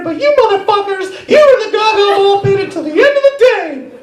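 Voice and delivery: high, loud shouting mouse; Mickey yelling at kids in the pit; ranting Mickey Mouse. Pretend. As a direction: rant like a fed-up Mickey. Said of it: "here's Mickey in the crow's nest".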